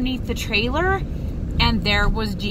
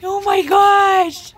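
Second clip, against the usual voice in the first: overwhelmed joy; 7 semitones higher, 5 dB louder, much slower than usual. Real, not acted. Overwhelmed by reunion.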